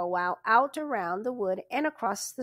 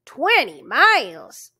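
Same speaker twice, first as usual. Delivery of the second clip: loud, slow dismayed groan of the words; groaning outburst; 3 semitones higher, 10 dB louder, slower than usual. A narrator's reading. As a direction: constable hears how far they must ride, loud dismayed groan. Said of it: "The constable groaned".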